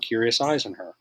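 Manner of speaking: plain reading voice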